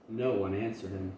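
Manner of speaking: ordinary delivery, nothing marked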